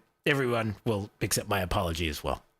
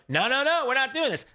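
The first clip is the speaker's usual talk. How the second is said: community pushback; 12 semitones higher than usual. Retold or imitated. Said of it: "the people in the community were like".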